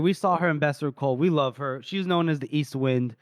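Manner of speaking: normal talking voice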